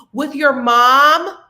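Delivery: slow, loud, scornful "with your mom"; mock outraged girlfriend; incredulous. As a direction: drag it out in disbelief.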